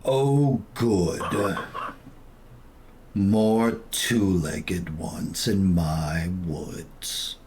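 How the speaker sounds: deep, slow, rumbling tree voice; low and drawn-out ancient tree; talking tree. Acted. GM voices woods creature.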